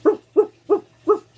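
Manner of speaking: high, loud, sharp yaps; short bursts of pretend barking; imitated dog bark. Shown as a demonstration. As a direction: bark like an excited little dog. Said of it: "I barked and my little dog".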